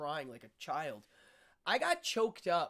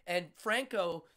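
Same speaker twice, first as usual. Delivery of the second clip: ordinary talk, nothing marked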